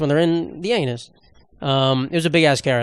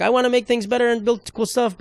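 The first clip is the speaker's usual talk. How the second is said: higher-pitched naive do-gooder; mock-innocent eager voice; innocent guy; 7 semitones higher than usual. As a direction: imitate a well-meaning innocent, pitched up. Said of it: "he's just an innocent guy almost".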